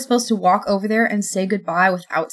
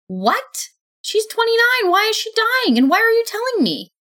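much higher, loud shocked outcry; shocked disbelief; 4 dB louder than usual. A narrator's reading. Told her ex will die, she cries out in shock. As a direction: cry out in stunned disbelief. Shocked at news of ex's death.

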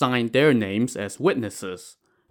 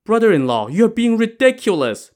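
high-pitched, louder protest; indignant protest; 11 semitones higher, 5 dB louder than usual. Acted. Accused widow answers brother-in-law, indignant protest. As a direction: push back, voice climbing steeply. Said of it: "Pan Jinlian protested".

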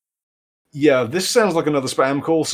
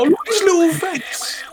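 high, squealed gush over something cute; cooing delight. Acted. Player seeing the little possum creature, high cooing squeal. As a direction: squeal it like baby talk, very high.

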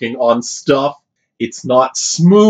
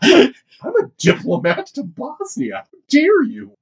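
indignant Southern diplomat; 12 semitones higher than usual. Acted. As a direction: play an outraged Southern gentleman. Mock offended Southern diplomat.

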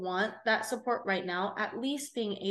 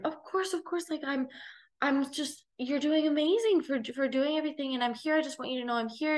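higher, earnest reassurance; reassuring stranger girl; 6 semitones higher than usual. Retold or imitated. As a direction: imitate the girl gushing support, pitched well up. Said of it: "she was like".

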